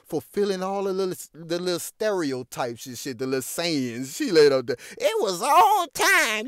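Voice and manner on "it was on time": high, slow, stretched mock glee; mock gleeful quote. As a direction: drag it out in a high parody voice. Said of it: "She said it came on time".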